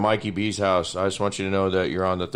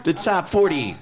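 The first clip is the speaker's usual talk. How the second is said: Casey Kasem impression; 9 semitones higher, 3 dB louder than usual. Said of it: "Casey Kasem".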